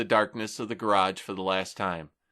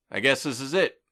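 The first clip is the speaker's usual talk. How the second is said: voice cracking; 6 semitones higher, 3 dB louder than usual. A narrator's reading. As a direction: voice breaking a little. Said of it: "My voice cracked a little".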